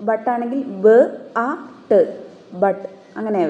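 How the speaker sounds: ബ, അ, ട് separated by pauses, then ബട്ട്; teacher sounding out a word. Shown as a demonstration. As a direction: break the word into sounds and rebuild. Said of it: "അതേപോലെ".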